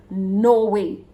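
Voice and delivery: emphatic "no way", drawn out and firm. Real, not acted. Speaker answering own question to close the speech, emphatic. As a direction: land "no way" with full weight. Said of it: "I say emphatically".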